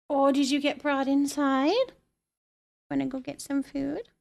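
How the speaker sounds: ordinary talk, nothing marked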